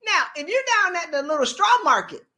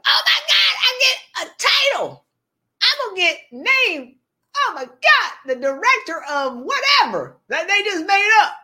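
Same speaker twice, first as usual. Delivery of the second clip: high, loud squeal of fake delight; overexcited employee getting a title; mock giddy joy; 7 semitones higher, 6 dB louder than usual. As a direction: gush like you just won a prize.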